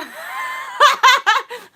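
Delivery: high, loud shout of laughter. Real, not acted. Vlogger laughing off her struggle, high and loud. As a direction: laugh it off loudly. Laughs after tearful quote.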